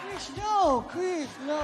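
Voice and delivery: high-pitched pleading objection; high mimicked "no". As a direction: gently stop the singer in a high voice. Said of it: "And [name] was like".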